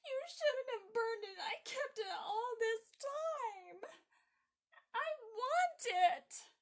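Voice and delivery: put-on high, loud, whining child's tantrum; making a fuss. A narrator's reading. Little girl to mother about burned card, wailing fuss. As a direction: drag out the words in a loud tearful protest. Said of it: "Margaret began to make a fuss".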